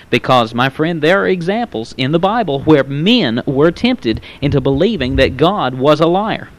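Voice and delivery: usual speaking voice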